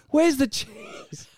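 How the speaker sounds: high, slow drawn-out demand; mock demand. Retelling the friend crashing photos, slow high demand. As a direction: stretch it out cheekily. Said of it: "everyone turns around".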